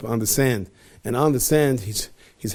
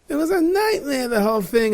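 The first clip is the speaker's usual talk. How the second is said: relieved exclamation; 13 semitones higher than usual. Acted. King waking from dream.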